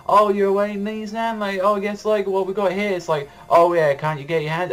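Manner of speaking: a sweet-sounding psycho sister; high mock-sweet sister voice; creepy sister voice. Voicing the creepy older sister.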